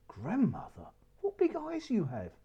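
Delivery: put-on high, wondering child's voice; little girl voice. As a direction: ask it like a wide-eyed little girl. Child questions the disguised wolf.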